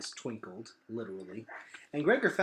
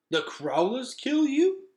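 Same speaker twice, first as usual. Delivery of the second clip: surprised; 12 semitones higher, 7 dB louder, slower than usual. A narrator's reading. Vicus amazed at question.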